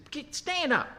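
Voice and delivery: high-pitched, urgent rebuke; acted scolding, pitch jumping way up; forthright. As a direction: order him to pull himself together, pitched high. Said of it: "he's pretty forthright".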